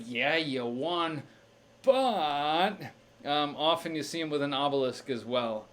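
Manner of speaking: usual speaking voice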